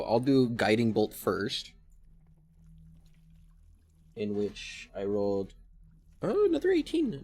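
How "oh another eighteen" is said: excited. Real, not acted. Excited roll result.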